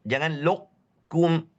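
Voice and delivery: "lukum" slow with a bounced qaf; drawn-out mistaken "lukum"; wrong lukum. Shown as a demonstration. Teacher warning against the wrong reading. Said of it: "Bukan seperti tadi".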